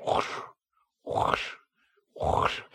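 quiet, breathy foot-dragging whoosh; soft shuffling whoosh. Retold or imitated. Father mimicking daughter shuffling down the hall, soft whoosh. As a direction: mimic slow sulky footsteps. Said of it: "She shuffles down the hall".

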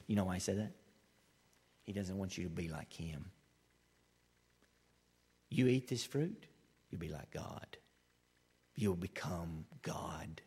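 sly tempter, hushed. Acted. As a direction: hiss the promise into her ear. Serpent tempting Eve with godhood.

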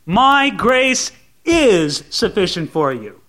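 IS held long and firm after a pause; slow down and hit the word is; deliberate, declarative emphasis on IS; stress on IS. Shown as a demonstration. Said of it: "God's word stands".

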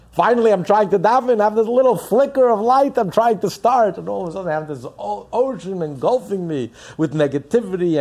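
loud, high, rising cry of frustration; impassioned preaching. Acted. Rabbi crying out to his listeners at full force.

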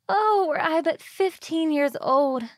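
put-on high, slow, wistful girl voice; young mermaid longing. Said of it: "said she".

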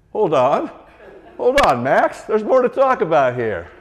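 high, loud mocking plea; mock objection. Preacher mimics churchgoer pleading with Max. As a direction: ham up the whiny protest.

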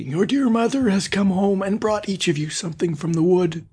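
high put-on mother voice; wolf crying out. A narrator's reading. Wolf luring the kids, high false mother voice. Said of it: "cried he".

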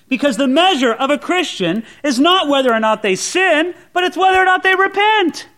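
high, loud, building proclamation; a high, loud pulpit climax; preaching at full force. Acted. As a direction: drive the point home from the pulpit.

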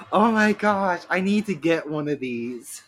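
gushing witch. Retold or imitated. Witch gushing about little bag.

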